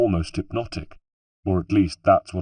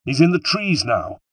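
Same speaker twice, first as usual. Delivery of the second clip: higher, louder urgent call; urgent shout to a companion; shouted alert; 7 semitones higher, 5 dB louder than usual. A narrator's reading. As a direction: call out where the quarry went. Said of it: "he shouted".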